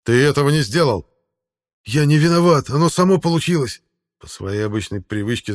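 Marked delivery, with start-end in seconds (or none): 0.0-1.1 raised, loud, stern voice; harsh accusation. A narrator's reading. War leader accuses young warrior, harsh reproach. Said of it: "сказал конунг жёстко". 1.9-3.8 high, loud protest; defensive excuse. A narrator's reading. Young warrior excuses himself to leader, defensive protest. Rebuked youth makes his excuse.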